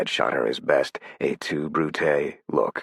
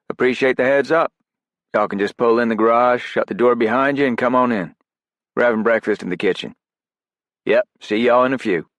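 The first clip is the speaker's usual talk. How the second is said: voiced operator on the phone, pitched up; raised, louder character read; 9 semitones higher, 7 dB louder than usual. Narrator voicing Grayson on phone.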